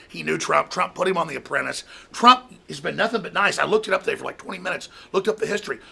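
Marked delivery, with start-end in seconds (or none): none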